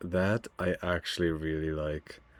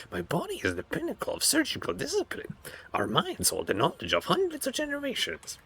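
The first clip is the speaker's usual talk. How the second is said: high, eerie surgeon creature voice; creepy homunculus voice; 6 semitones higher than usual. Acted. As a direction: voice a gloating flesh-crafter, high and strange. Reads homunculus lines in game.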